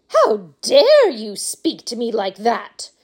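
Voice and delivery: a mild, drawn-out shout of outrage; outraged shout. A narrator's reading. Insulted mother rebukes Wonka, mildly shouted outrage. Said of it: "shouted Missus Salt".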